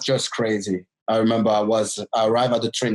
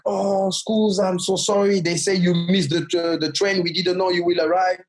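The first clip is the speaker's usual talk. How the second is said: a touch of an apologetic driver, higher; 6 semitones higher than usual. Retold or imitated. The club driver apologizes to the stranded boy, lightly higher and sorry. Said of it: "he was like".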